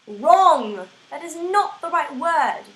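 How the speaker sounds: loud blurted verdict; emphatic yell. Real, not acted. Ranting at whoever misspelled there, loud outburst. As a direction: shout the word down as a flat rejection.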